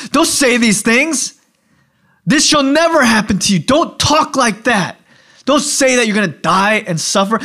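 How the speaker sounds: high, insistent rebuke; acted-out alarmed objection; urgent protest. Peter rebukes Jesus in acted quote.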